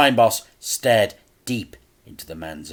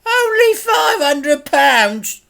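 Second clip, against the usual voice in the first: much higher, slow, outraged mimic; mocking echo; 9 dB louder, slower than usual. A narrator's reading. Crime boss mocks Dad's sum, high and slow. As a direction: sneer it back in disbelief.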